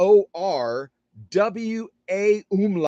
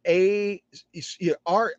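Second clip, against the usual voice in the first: ordinary talk, nothing marked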